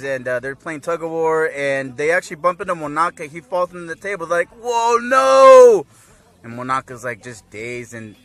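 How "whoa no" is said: loud, high, stretched-out cry of dismay; hammed-up horror; mock alarm. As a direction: imitate a crowd watching disaster happen. Acting everyone's alarm at fall.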